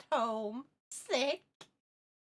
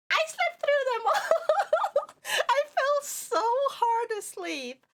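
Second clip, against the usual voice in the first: laughing; 11 semitones higher, 4 dB louder, slower than usual. Real, not acted. Laughing at oversleeping.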